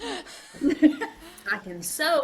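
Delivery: slow, laughing high boast; giggly slow brag. Acted. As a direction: drag it out through a laugh. Mock sing-song boast, laughing.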